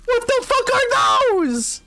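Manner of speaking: very high, stretched-out shocked squeal; shrieking disbelief. Real, not acted. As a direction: panicky high-pitched what the fuck. Freaked out by strange creatures.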